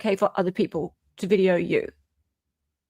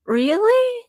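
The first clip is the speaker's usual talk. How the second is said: much higher disbelieving question; incredulous; 12 semitones higher than usual. Real, not acted. Cohost challenging Lauren's complaint, incredulous. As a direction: let the disbelief jump up.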